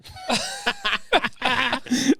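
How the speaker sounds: high bursting laugh at the punchline; high burst of laughter. Real, not acted. Friend laughs at the train manager's upgrade line, high laugh.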